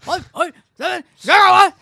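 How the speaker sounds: high, loud panicked scientist; Doc Brown shout. Retold or imitated. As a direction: yell the number in disbelief.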